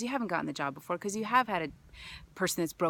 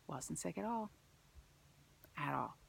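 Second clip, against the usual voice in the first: hushed whisper, stressing at all; softly whispered relief; 12 dB quieter than usual. Real, not acted. Hushed emphasis.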